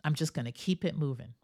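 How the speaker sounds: quick, breezy "keep it moving"; brisk quote. Retold or imitated. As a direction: toss it off quickly and move on. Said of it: "Like a good friend of mine says".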